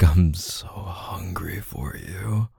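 slow, hungry whisper. Acted. Lover murmuring to his partner in a slow whisper. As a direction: breathe it close to the ear, slowly.

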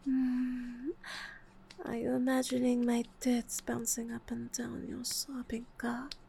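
ordinary talk, nothing marked

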